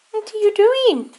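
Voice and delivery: high cooing pet talk; silly cat voice. Real, not acted. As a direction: coo the question to the cat. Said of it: "silly cat voice".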